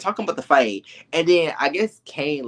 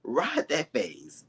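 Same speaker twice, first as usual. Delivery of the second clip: slow emphatic; 4 semitones higher, 6 dB quieter, much slower than usual. Retold or imitated.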